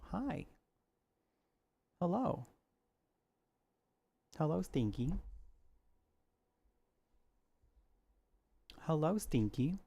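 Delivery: high, soft cooing greeting; high soft greeting to the kitty; pet voice. Real, not acted. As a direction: coo hello to the cat. Greeting the cat sweetly.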